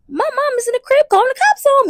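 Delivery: high-pitched mock outrage; high comic exclamation; exaggerated disbelief. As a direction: pitch it way up, scandalized. Exasperated outburst in the telling.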